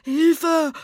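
high, loud cry for help; terrified yell; frightened scream. A narrator's reading. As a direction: shriek for help in panic. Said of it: "schrie das Gespenst".

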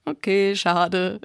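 a light laugh through the words. A narrator's reading. Aton gives in to his sister, laughing. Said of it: "lachte Aton".

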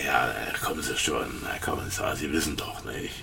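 conspiratorial hushed nudge; sly whisper. Acted. Witness slyly whispering to the commissioner about their trade. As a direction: whisper it like a shared secret.

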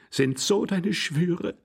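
high, slow reproachful cry; calling out. A narrator's reading. Young man confronts Glafira about her vows, calling out high. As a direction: cry it out, drawn out. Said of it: "rief der junge Mann".

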